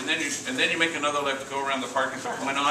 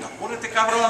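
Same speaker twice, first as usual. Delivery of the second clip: higher, louder hurry-up call; 10 semitones higher, 4 dB louder than usual. Retold or imitated. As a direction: call across the store to hurry him. Calling out to homie across store.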